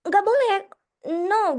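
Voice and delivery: high, loud sharp scold; angry scolding teacher. Retold or imitated. Teacher yells at the child hitting the table, loud sharp scold. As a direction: snap at the pupil to stop now.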